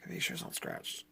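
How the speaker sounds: breathy whisper while checking the disc; hushed whisper as he inspects the disc; whisper to himself. Real, not acted. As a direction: whisper it under your breath.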